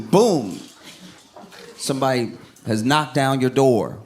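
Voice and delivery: ordinary delivery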